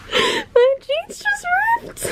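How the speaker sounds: high breathy gasp of dismay, whispered; shocked whisper. Real, not acted. Vlogger discovering her favorite jeans ripped, whispering in shock. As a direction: whisper it like you can't believe it.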